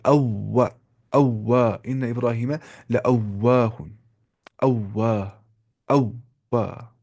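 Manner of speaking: अव्वा with rounded lips on the doubled w. Shown as a demonstration. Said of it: "होंठों में गोलाई हो". (होंठ गोल करके अव्वा)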